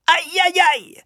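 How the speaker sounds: very high "aïe" yelps; howl of pain. A narrator's reading. Schoolboy caned a second time, howling. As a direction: yelp sharply in pain. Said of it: "hurla Thwaites".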